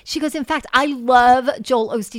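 imitating an excited friend, voice lifted; retold excitement. Friend confiding her new love of Joel Osteen, excited. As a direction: relay her gushing enthusiasm with punched words. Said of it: "I'm so excited to share this".

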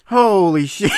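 laughing shout. Real, not acted. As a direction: exclaim it mid-laugh. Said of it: "I cannot stop laughing".

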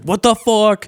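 high, loud outraged scream, retold; imitated screaming. Bartender hearing the prank song yet again, imitated furious scream. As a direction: imitate the bartender screaming at the top of his lungs. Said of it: "screaming at the top of his lungs".

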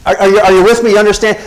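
normal talking voice

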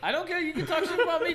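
laughing. Real, not acted. Defending his dog, laughing.

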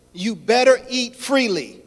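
loud, slow, commanding voice of God. Acted. Preacher voicing God's command to Adam, loud and slow. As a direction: lean on each word like a royal order.

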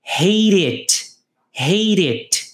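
"hate it" run together, the T tapped into "it" like "heirit"; linked hate it. Shown as a demonstration. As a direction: link "hate" straight into "it" with a tapped T. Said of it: "olha como eu falo aqui o hate mais it". ("hate it" emendado, o T soando como R)

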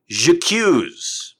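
theatrical French "J'accuse"; dramatic accusation. Shown as a demonstration. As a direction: fling "J'accuse" at the crowd.

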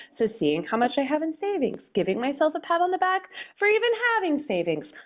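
high, lilting sing-song; sing-songy voice. Shown as a demonstration. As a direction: chirp it to yourself like a tune. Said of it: "Talking to myself in a sing-songy voice like this".

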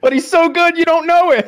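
very high, loud squeal; squeaky protest. Real, not acted. Defending favorite actor's mistaken nationality, high squeaky protest. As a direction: shoot up into a comic squeak.

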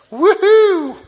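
loud, high-pitched woohoo; high whooping cheer. Acted. Preacher celebrating the treasure chest verse, whooping cheer.

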